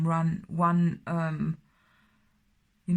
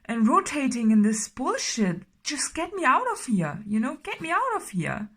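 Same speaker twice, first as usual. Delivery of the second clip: higher, pleading outburst at the universe; voicing someone fed up with life, higher and louder; exasperated quote; 7 semitones higher, 4 dB louder than usual. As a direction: cry out in frustration at being stuck. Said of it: "And you're like".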